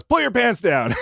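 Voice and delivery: costumed burner orders them out; commanding burner. Retold or imitated. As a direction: bark the order at the scared kids. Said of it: "he goes".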